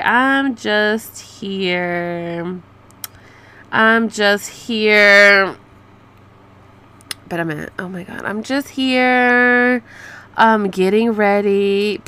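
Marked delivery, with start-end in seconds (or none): 0.0-5.6 very drawn-out mocking repeat; mock it slowly, dragging every word; mocking drawl. Mockingly repeats the texted reply. 8.2-12.0 very drawn-out mocking repeat; mock it slowly, dragging every word; mocking drawl. Mockingly repeats the texted reply.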